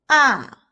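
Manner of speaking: "ah" starting high, dropping fast; falling tone. Shown as a demonstration. Teacher leads repeat drill, falling ah. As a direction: slide "ah" down from the top. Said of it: "The fourth tone is the falling tone".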